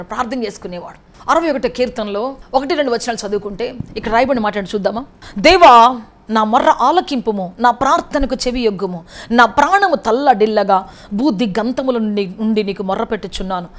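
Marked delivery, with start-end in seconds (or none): 5.4-13.7 loud, pleading prayer read aloud; fervent psalm reading. Acted. Preacher reads Psalm 61 as a loud cry to God.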